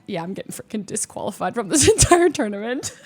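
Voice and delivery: half-laughing at own doom; laughing in disbelief. Retold or imitated. Answering the opponent about calling a judge, laughing. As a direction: laugh through the line. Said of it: "And I was like".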